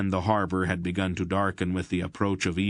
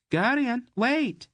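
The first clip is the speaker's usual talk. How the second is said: much higher, bright call after him; Mady running after Garion, calling; calling girl; slower than usual. Acted. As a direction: call out to stop him leaving.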